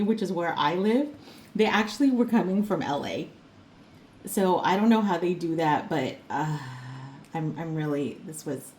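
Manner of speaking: usual speaking voice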